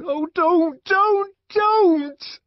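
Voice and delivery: high, loud screamed plea; screaming. A narrator's reading. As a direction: scream the plea at full pitch. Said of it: "Linda screamed".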